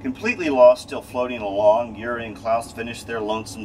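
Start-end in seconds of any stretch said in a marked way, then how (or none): none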